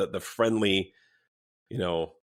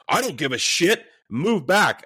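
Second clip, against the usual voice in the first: higher, louder mock yell; retold angry outburst; 8 semitones higher, 6 dB louder, slower than usual. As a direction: imitate a bully cutting someone off. Retelling bully cutting off elder.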